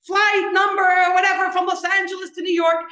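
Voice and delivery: loud, high announcer call; retold shout. Drunk son on the sidewalk shouting a mock flight announcement. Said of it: "he's shouting".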